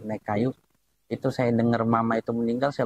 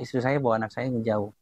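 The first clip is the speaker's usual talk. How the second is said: usual speaking voice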